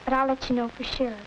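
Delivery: ordinary talk, nothing marked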